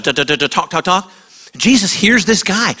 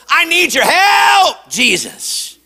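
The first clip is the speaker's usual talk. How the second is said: retold shout; 7 semitones higher, slower than usual. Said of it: "he continues to shout".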